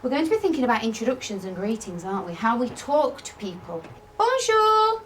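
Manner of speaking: put-on lively, sing-song voice for young pupils; classroom teacher voice. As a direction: talk the lesson aims through with the children. Teacher addresses her class.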